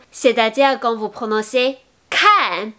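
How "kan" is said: "kan" with air bursting out on the K; aspirated K. Shown as a demonstration. Teacher explaining the expiration sound, aspirated K in "kan". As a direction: puff breath out as "kan" begins. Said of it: "vous sentirez l'air sortir".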